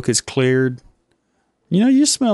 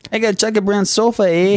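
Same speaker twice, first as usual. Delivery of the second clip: mock Canadian; 7 semitones higher, faster than usual. S0 mocks Canadian, high and fast.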